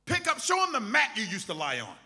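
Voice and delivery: loud, high preaching call. Acted. Preacher urging the church to show their mat, loud. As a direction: drive it home to the crowd.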